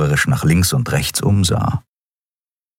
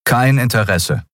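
firm refusal, slightly raised; 4 semitones higher than usual. A narrator's reading. Kai refuses the dealer, firm. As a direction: turn him down firmly. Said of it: "sagte Kai mit fester Stimme".

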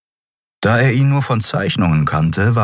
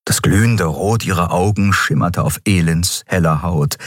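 plain reading voice

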